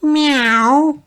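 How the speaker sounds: very high, loud kitten mew; cat meow. A narrator's reading. Peppa dressed as a witch's cat, high meow. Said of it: "purred Peppa".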